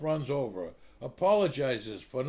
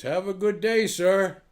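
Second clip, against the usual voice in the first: louder, higher brusque send-off; loud punchline; 5 semitones higher, 7 dB louder than usual. Acted. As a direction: bark it out as the punchline. Trooper dismisses the joking driver.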